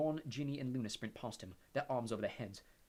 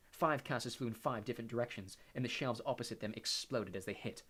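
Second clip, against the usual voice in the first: usual speaking voice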